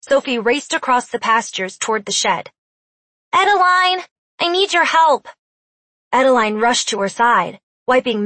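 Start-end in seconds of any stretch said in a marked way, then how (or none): 3.3-4.0 called out high and loud; calling out. Acted. Sophie runs for help. 4.4-5.3 high, loud plea for help; urgent call. Acted. Sophie runs for help.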